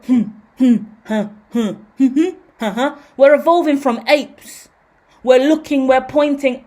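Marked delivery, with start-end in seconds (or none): 0.1-3.0 short breathy caveman hums and huhs; Neanderthal grunts. Acted. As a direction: grunt wordlessly like an early human. Imitating primitive Neanderthal grunts.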